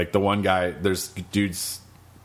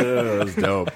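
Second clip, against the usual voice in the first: ordinary talk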